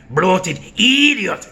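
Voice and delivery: high, loud, slow cartoon chihuahua screech; hammed-up Ren Hoek accent, stretched and shrill; Ren Hoek impression. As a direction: spit it out like a deranged cartoon dog. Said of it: "she sounds exactly like Ren from Ren and Stimpy".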